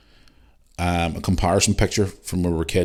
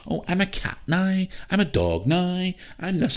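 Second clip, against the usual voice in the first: high-pitched mock kid voice; mocking children; 11 semitones higher, 4 dB quieter than usual. Parent scoffing at kids calling themselves cats and dogs, mocking high voice. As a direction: put on a silly, high little voice to ridicule them.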